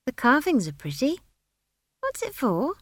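put-on high, tentative child's questioning; uncertain. A narrator's reading. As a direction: ask it unsurely in a high young voice. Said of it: "she said uncertainly".

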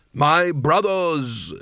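slow, drawn-out ceremonial address; intoning. A narrator's reading. Carding addresses the assembled wizards, intoning. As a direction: intone it to the whole hall. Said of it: "he intoned".

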